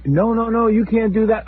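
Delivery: high, lightly voiced wife protesting; wife begging him not to go, high mock protest. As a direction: squeal it like the upset wife.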